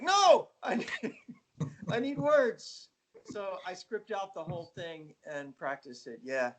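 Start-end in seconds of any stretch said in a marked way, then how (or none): 0.0-2.9 high, laughing protest; laughing exclaim. Retold or imitated. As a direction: blurt it with a laugh. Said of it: "And I'm like".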